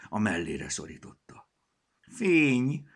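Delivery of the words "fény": high meowed cry of relief; cat cries out in relief, meowing; relieved meow. A narrator's reading. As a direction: yowl it out like a freed cat. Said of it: "nyávogott fel megkönnyebbülten Picasso".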